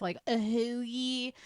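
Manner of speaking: put-on Delco accent, slow and drawn out. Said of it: "I hate that accent".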